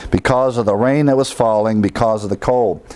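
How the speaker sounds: usual speaking voice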